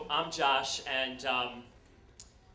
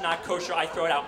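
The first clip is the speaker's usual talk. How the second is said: ordinary talk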